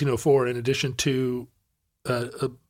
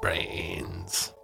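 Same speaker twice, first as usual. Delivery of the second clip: zombie whisper; 7 semitones lower, 12 dB quieter than usual. Pretend. Zombie joke.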